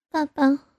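sleep talk. A narrator's reading. Daughter pretending to be asleep, sleep-talking. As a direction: murmur it as if talking in your sleep. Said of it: "口中说着呓语".

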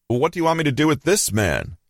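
storyteller's restrained shout; shouting over crowd. A narrator's reading. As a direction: pitch it up as if over a noisy crowd. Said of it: "Pilate shouted over the crowd".

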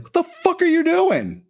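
high, disbelieving yell with a laugh; incredulous shout. Acted. Joe yells at Otto walking out, high incredulous shout.

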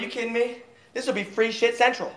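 ordinary talk, nothing marked

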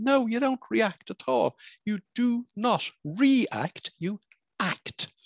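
"You do not react" slowed right down, then "act" set apart; stress on react and act. Shown as a demonstration. Coach tells listeners how to respond, slow emphatic contrast. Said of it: "I keep saying to them".

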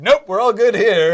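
mock breezy exit. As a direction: wave it off like you're out the door. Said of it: "so I felt when I left Iraq, like".

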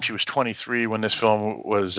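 normal talking voice

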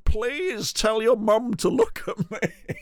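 high, slow, imploring voice; hammed-up slow plea. Sweating man begs the kid through glass, slow high plea. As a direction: draw the plea out, pitched high. Man behind window begging child.

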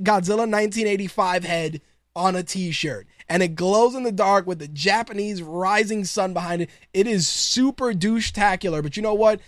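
usual speaking voice

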